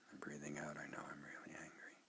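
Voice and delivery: very soft, almost whispered; murmured quietly to yourself; hushed meditation. Shown as a demonstration. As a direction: let it fade inward on the out-breath. Models silent meditation phrase.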